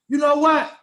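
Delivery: loud, high mock snap; acted angry outburst. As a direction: imitate her blowing up before a smack. Acts woman just before the smack.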